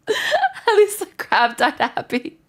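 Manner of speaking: high, slow giggling line; laughing. Real, not acted. Cracking up about the crab, high laughing voice. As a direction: let the laugh ride through the words.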